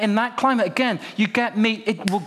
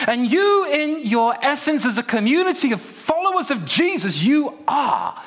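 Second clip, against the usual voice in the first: rising passion; 6 semitones higher than usual. Acted. Preacher building to a climax.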